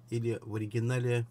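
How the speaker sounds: ordinary talk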